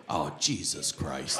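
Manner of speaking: low, slow, soft weary groan; a father sighing out in disbelief, low; dad's groan. Retold or imitated. Dad at the bathroom door, low slow groan of disbelief. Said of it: "he goes".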